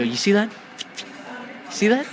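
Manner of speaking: worked-up, pointed taunt; taunting demand. Acted. Taunting a captive over an ear.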